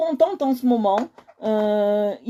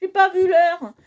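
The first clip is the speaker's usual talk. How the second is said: high, loud surprised cry; startled at the hour, high and loud; 9 semitones higher, 3 dB louder than usual. Real, not acted. Realising the time, excited cry.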